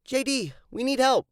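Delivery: high, loud call across the lake; yelling for J D to come help. Acted. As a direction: shout it to someone far off. Calling to J D from afar.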